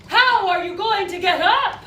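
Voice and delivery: mock her as she grovels; loud laughing taunt. Taunting the maid on the floor.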